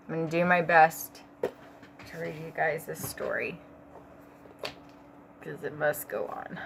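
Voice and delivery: hoarse, tired and ill; sick voice. Real, not acted. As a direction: push through being sick. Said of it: "I'm just so sick".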